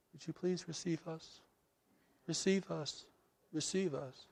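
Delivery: little pleading voice. Retold or imitated. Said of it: "by this little voice saying".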